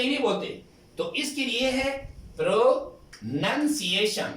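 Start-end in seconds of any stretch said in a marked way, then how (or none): 2.4-4.3 pronunciation said slowly in separate parts, pro, nan-si-ye-shan; slow teacher's breakdown of pronunciation; broken into syllables. Shown as a demonstration. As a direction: sound out pronunciation with a pause after pro. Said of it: "This is called syllable".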